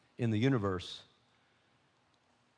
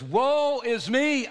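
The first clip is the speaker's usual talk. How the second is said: high, slow anguished cry; drawn-out lament, raised high; crying out woe; 13 semitones higher, 8 dB louder, much slower than usual. Acted. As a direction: wail it like a ruined man. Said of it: "I cried".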